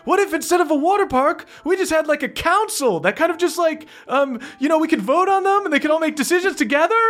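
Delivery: high, fast, timid suggestion; high elf guard. Acted. Guard in the crowd proposes a council, high and fast. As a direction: pipe up nervously and quickly. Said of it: "just goes".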